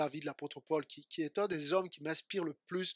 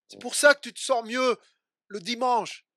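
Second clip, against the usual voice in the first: raised, loud exhortation; full-force preaching; 4 semitones higher, 8 dB louder than usual. Acted. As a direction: drive the problem home to the church. (prédication à voix haute et forte)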